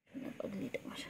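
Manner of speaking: soft whispered pet talk; tender whisper. Real, not acted. (ফিসফিস করে আদর)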